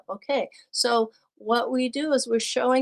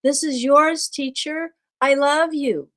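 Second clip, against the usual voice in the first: put-on louder, higher voice of an eager little boy; a child thanking his teacher; child's voice; 5 semitones higher, 6 dB louder than usual. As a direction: hand over the gift proudly. Child gives teacher a gift.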